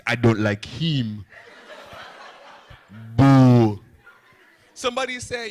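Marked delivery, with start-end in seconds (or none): 0.1-3.8 slow, low mama voice then a huge boo; mama's heckle. Retold or imitated. Haitian mom heckling her son at his show, slow and loud. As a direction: drawl it then boo hard. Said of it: "she did this".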